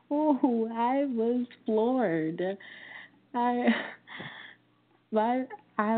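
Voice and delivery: real disbelief, words failing; floored. Real, not acted. Stunned recalling child's reaction.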